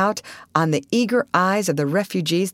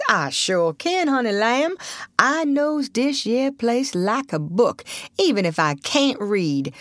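drawling dialect voice, pitched up; old Southern boatman, obliging; 6 semitones higher than usual. Acted. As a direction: play a folksy old Southern local.

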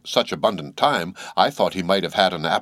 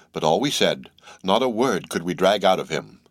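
ordinary delivery

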